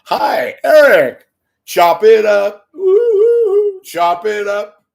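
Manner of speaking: very high, loud, slow sing-song voice; high sing-song mock menace with a falsetto woo-hoo; creepy inmate greeting. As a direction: croon it like a gleeful lunatic. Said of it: "next to the Menendez brothers".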